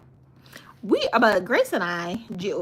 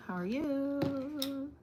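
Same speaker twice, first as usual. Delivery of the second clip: singsong greeting; 7 semitones higher, 9 dB quieter, much slower than usual. Acted. Repeats greeting to viewer.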